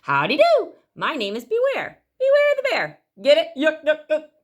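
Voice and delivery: loud, high, goofy bear joke; cheesy joking bear; jolly bear. A narrator's reading. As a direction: ham up the punchline with a chuckle.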